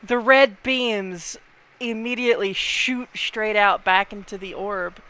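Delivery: loud, slow dramatic narration; game master's scene setting. A narrator's reading. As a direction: announce the orb's revival with weight. Game master narrates the orb repairing itself.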